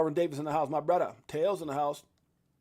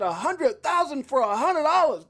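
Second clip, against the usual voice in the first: louder, higher, slow quote; raised, slow imitation of the call; quoting a friend; 8 semitones higher, 7 dB louder, slower than usual. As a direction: hype the famous call. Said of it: "when C K J said".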